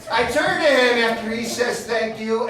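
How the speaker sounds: ordinary talk, nothing marked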